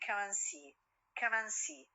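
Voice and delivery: "and" shrunk to a quick "ən"; reduced "and". Shown as a demonstration. Teacher gives an example sentence, weak "and". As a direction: run "and" into its neighbours as "un". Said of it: "و ال and عندي ال n".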